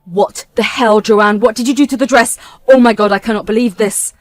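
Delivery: loud, outraged demand; a groom yelling at his sister over the wrecked dress; furious. Acted. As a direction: let the fury out at his sister. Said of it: "Ian was furious and said".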